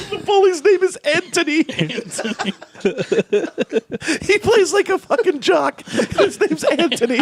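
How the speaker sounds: high-pitched laughing voice. Real, not acted. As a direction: laugh through the words. Laughing while naming bully.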